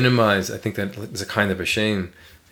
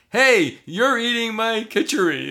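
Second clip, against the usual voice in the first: higher, slow teasing accusation; mock accusation; 10 semitones higher than usual. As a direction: drawl it out teasingly. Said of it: "I said".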